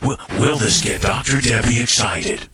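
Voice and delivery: deep booming game-show announcer. Shown as a demonstration. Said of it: "I wanna talk like that announcer guy".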